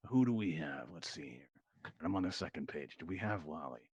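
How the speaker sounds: low, soft reading under one's breath; talking to oneself. Real, not acted. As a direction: quietly check a list aloud. Host checking the contestant list aloud.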